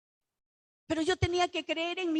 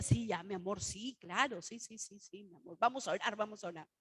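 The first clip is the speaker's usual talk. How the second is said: humoring; 6 semitones lower, 7 dB quieter than usual. Retold or imitated. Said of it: "mi esposo me decía".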